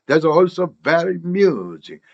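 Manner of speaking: slow "very amusing" with the first a melted into very; absorbed vowel. Shown as a demonstration. Teacher models the phrase, "very amusing" slowly linked. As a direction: draw out "That's also very amusing", all linked. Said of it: "amusing의 어도 부정관사하고 똑같이".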